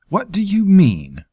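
high, loud and slow snap; snapped. A narrator's reading. Lord demands the sergeant explain, snapping. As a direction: bite the question out. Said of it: "Terrell snapped".

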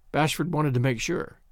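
ordinary delivery

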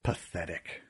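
put-on a villain sneering at a sobbing boy; contempt. A narrator's reading. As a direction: spit it out with scorn. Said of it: "Kealty said".

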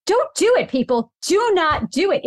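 high, punchy plea on DO; emphatic warning. Real, not acted. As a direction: hit every DO hard and high. Pet peeve rant.

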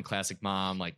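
normal talking voice

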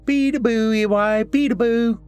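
put-on very high-pitched playful child's cry; toddler yell. A narrator's reading. Little Jack uncovers his face to Eli, high toddler yell. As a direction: squeal it like a little kid at peekaboo. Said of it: "and yelled".

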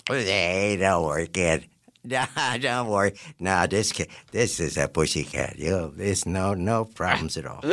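low, easygoing wrangler reassurance; lightly voiced wrangler. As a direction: lightly imitate a wrangler brushing off worries. Wrangler's reply acted in retelling.

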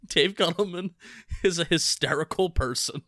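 laughing through the words; giggling as you speak. Real, not acted. Laughing while describing.

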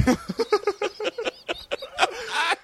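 helpless high-pitched laughter; high, breathless laugh. Real, not acted. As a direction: laugh till it almost turns to crying. Sister's crack remark lands.

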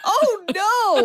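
high mock gasp of alarm. Reacting to a playful death threat with a high mock squeal of alarm. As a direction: squeak it out like a fake fright.

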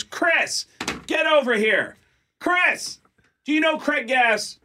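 high, insistent shout across a room; excited high-pitched summons; calling someone over. Retold or imitated. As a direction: hail a friend in a pushy, high voice. Belzer calls Walken over.

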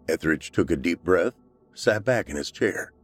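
plain reading voice

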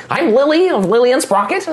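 high, prim gnome voice; old gnome lady. Acted. Gnome wife correcting the name order, high and prim. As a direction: introduce herself prim and proud. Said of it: "she's like".

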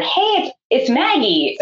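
imitated high-pitched greeting at a door; lilting hello, announcing yourself; calling hello. Midwife knocking on the hospital room door, calling hello.

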